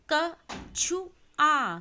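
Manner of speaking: पुल drawn out slowly and loud, each sound clear; slow syllabic reading. Shown as a demonstration. Teacher reads पुल slowly, loud and stretched. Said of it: "देखिए कि मैं किस तरीके से पढ़ रही हूँ".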